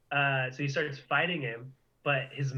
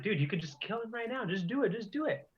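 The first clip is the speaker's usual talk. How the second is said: higher-pitched exasperated urging; retold urging; 7 semitones higher, 3 dB quieter, faster than usual. Viewer urging Vegeta to finish off Cell, retold urging. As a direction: egg them on in a higher, quick voice.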